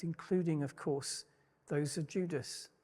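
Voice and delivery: normal talking voice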